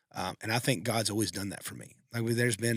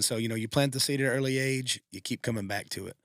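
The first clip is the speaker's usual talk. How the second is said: normal talking voice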